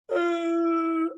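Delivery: high, drawn-out mock wail; hammed-up long sobbing howl; acted crying wail. Mother continues to cry at child, acted wailing. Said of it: "so she continues to cry".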